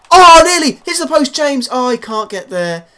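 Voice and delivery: loud, high cry falling away in disappointment; near-miss shout. Real, not acted. Commentator sees the shot hit the post, excited yell fading. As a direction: burst out, then sink as the chance goes.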